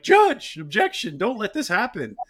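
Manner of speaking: mock a lawyer crying foul to the judge; whiny lawyer. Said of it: "he would be like".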